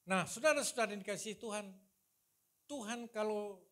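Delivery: usual speaking voice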